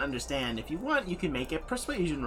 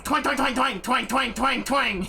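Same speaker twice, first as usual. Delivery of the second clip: sung twangs, a bit higher; lightly higher twanging noises; twang sound effect; 7 semitones higher, 4 dB louder than usual. Acted. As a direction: pluck out each twang like a bowstring. GM voices crossbow shots.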